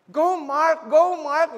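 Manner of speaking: loud proud cheer; cheering shout. Retold or imitated. Mother cheering her wrestler son, loud shout. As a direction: yell for him from the crowd.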